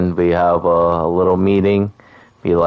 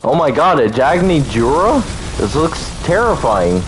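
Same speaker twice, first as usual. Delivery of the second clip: higher-pitched astonished reaction; real startled amazement; 7 semitones higher than usual. Real, not acted. Player seeing a terrifying creature in game, startled amazement. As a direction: react aghast at what just appeared.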